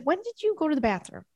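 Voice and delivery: high, fast worried-owner questioning; questioning the cats. Acted. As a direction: quiz the cats in a quick, high voice. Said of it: "we're always like".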